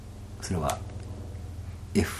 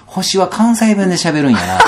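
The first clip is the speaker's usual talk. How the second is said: slow, high and louder through a laugh; 11 semitones higher, 8 dB louder than usual. Real, not acted. Telling the host stars speak Kansai, laughing. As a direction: laugh the punchline out.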